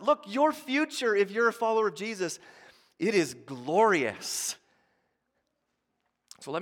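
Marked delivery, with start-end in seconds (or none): 0.0-4.5 loud, higher preacher climax building to joy; thundering pulpit sermon, louder and higher; full-force preaching. Acted. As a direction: preach it with everything you've got.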